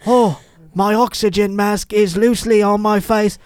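high, slow, loud bad British accent; mock British invalid. Parodying the fugitive trying to stand, exaggerated British accent. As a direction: ham up a struggling British patient.

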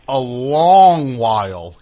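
loud, stretched-out Southern drawl; Southern accent. Shown as a demonstration. Host showing his friend's rare twang, exaggerated Southern accent. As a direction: draw it out big and slow like a Southerner. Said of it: "of a Southern accent. Once in".

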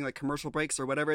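usual speaking voice

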